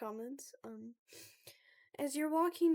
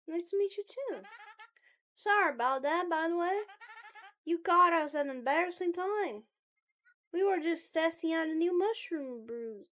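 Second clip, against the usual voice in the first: higher, louder friendly student; Mabel; 7 semitones higher, 9 dB louder than usual. Acted. Club member apologizing to the newcomer, high and lively. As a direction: lively welcome in a raised voice.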